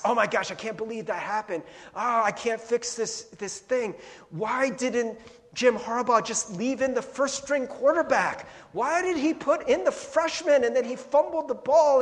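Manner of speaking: mock fretting. Worried inner voice about past.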